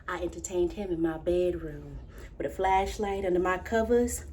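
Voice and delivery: low, hushed confession; soft and secretive, as if under the covers; confiding a secret. Acted. Confides childhood secret lover.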